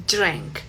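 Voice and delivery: drank with a soft D; drank, the D gliding toward Y; English drank. Shown as a demonstration. Teacher modeling English drank. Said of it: "vas a decir drank".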